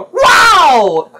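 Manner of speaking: high, loud drawn-out cry of wonder; amazed kids. Acted. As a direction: shout like a thrilled child from another room. Kids exclaim off-scene.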